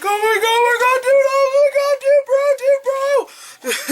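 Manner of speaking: frantic high falsetto yelling; mock panicked screaming. Naked guy at the door, frantic high screaming. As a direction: squeal it in full panic, way up high. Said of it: "Just screaming".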